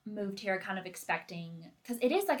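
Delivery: ordinary talk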